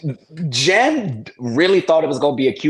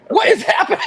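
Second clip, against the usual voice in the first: high, amazed what is happening; surprised; 14 semitones higher, faster than usual. Real, not acted. Cohost reacting to the clip, surprised and laughing. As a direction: ask it in laughing disbelief.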